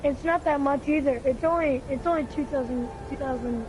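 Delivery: usual speaking voice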